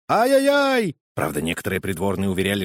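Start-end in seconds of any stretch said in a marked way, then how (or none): 0.1-1.0 very high, loud yelp of pain; howling in pain. Acted. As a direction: howl it like a bitten finger. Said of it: "он завопил".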